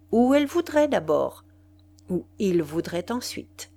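ordinary delivery, nothing marked

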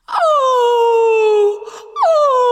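high, loud dog wail; anguished howl. A narrator's reading. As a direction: wail in misery. Dog wails again.

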